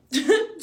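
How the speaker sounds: short, high, disbelieving laugh; burst of laughter. Real, not acted. Mother laughing at a twenty-five dollar Aquaphor charge. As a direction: laugh out at the absurd price.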